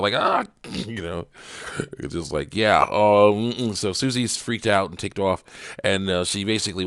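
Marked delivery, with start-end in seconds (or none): 0.1-0.4 cartoonish anguished ah; cry out. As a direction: yelp like the news just hit. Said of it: "so he actually cries out".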